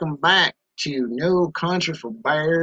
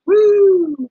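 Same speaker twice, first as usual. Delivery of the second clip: excited whoop; 8 dB louder than usual. Real, not acted. Cheering for moonshine.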